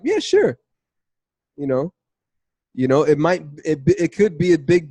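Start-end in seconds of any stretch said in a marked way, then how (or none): 0.0-0.6 high, careless "Yeah, sure"; casual agreement without real desire; offhand yes. Retold or imitated. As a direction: toss off a lukewarm yes. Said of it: "or if it's like".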